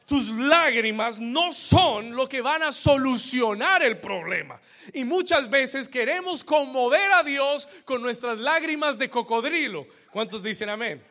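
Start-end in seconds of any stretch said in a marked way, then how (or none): 0.0-9.9 loud, high, driving sermon voice; emphatic preacher's proclamation; full-force preaching. Real, not acted. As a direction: launch into preaching with full power. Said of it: "vamos a comenzar a predicar ahora".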